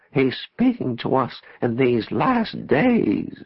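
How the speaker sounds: very slow, high, quavering prophecy; drawn-out, wavering announcement; prophetic waver. A narrator's reading. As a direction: proclaim it like an entranced prophetess. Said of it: "prophetic waver in her voice".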